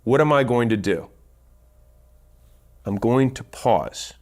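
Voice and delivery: long silent pause before "I'm going to pause"; pause for emphasis. Shown as a demonstration. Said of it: "if I want to emphasize".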